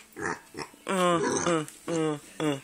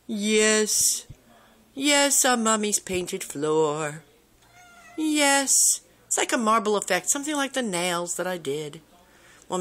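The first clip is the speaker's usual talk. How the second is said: usual speaking voice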